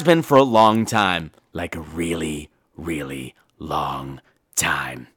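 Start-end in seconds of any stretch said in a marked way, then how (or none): 1.5-5.1 very low, slow, hushed; ominous. Acted. Storyteller revealing the villain's great age, ominous. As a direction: draw it out in a deep spooky murmur.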